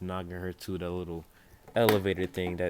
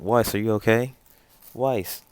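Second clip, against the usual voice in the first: louder, higher acted call to a teammate; concerned calling out; 4 semitones higher, 9 dB louder than usual. Running towards Weiss after the spear hit, calling out in concern. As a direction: call out to check on an injured friend.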